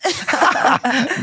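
loud, high laugh at his own joke; high burst of laughter. Real, not acted. Laughing at his own Jeremiah joke, high and loud.

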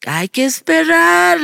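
high, loud, stretched-out urging; acted emphatic answer; retold insistent reply. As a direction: insist on patience, calling it out. Acts out her insistent reply.

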